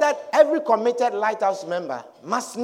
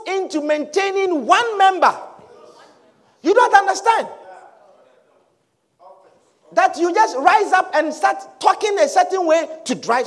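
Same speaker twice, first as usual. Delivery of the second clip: raised, forceful sermon delivery; emphatic preaching; 7 semitones higher, 5 dB louder than usual. Acted. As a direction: preach it to the congregation, pitch lifted.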